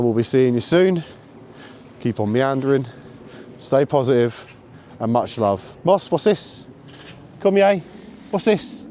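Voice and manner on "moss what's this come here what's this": high-pitched recall; calling the dog. Real, not acted. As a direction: call him over.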